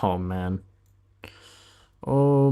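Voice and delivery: normal talking voice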